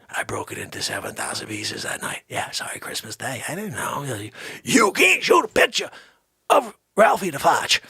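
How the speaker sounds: normal talking voice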